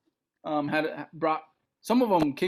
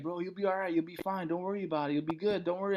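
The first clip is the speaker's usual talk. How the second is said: soft, calm reassurance; softer, reassuring comedian friend; 3 semitones higher, 5 dB quieter, faster than usual. Retold or imitated. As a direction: soothe a nervous friend quietly. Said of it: "he's like".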